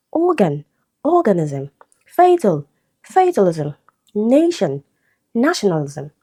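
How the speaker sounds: each word hit on its first syllable: "organ", "organism", "fatal", "fatalism", "nation", "nationalism"; first-syllable stress. Shown as a demonstration. Teacher listing root and suffix pairs, first-syllable stress. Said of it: "stressed on the same syllable as the root".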